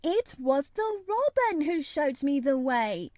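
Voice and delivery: put-on high-pitched, stubborn little girl; obstinate protest. A narrator's reading. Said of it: "she protested obstinately".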